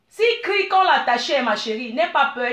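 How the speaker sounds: usual speaking voice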